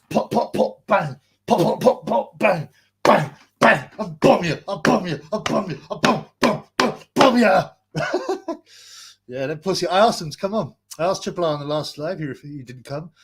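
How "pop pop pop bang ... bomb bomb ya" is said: loud, higher voiced gun noises pop, pop, bang; mock gunfire taunt. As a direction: fire off the pops and bangs like a cartoon shootout at the rival.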